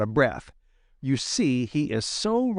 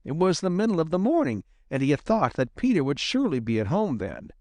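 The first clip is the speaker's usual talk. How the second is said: ordinary delivery, nothing marked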